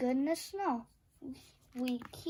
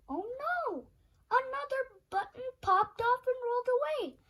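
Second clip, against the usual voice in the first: mock dismay; 9 semitones higher than usual. Another button lost.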